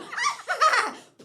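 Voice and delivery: loud, very high evil cackle; witch cackle. Pretend. Comedian cackling as the witch. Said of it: "Wizard of Oz".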